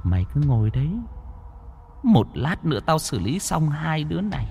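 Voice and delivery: soft, contemptuous spirit voice rising to a high curse; vengeful ghost scorning her unfaithful lover; bitter ghost. Acted. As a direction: spit scorn at the man who betrayed her. Ghost berates faithless lover.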